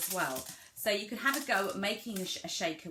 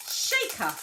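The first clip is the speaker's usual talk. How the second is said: higher, emphatic shaker; model word for child; 7 semitones higher, 5 dB louder than usual. Shown as a demonstration. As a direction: say it up high, as if to a toddler. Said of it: "you could say".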